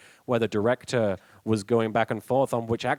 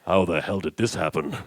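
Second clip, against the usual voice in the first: low character voice, astonished; Yami; 5 semitones lower, slower than usual. Acted. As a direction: play a deep-voiced cartoon hero taken aback. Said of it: "Yami pulls it out and he's like".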